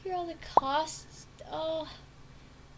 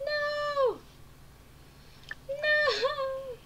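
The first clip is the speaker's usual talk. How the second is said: mock panic scream. Player yells no as mom walks in, hammed-up.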